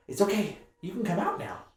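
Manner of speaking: put-on high, bright girlish voice; young half-orc girl. Dorothea coaxing the hiding player out, high girlish voice. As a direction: coax them out like an eager kid.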